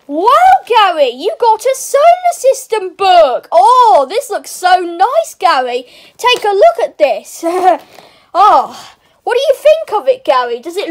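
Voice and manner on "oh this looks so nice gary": ordinary talk, nothing marked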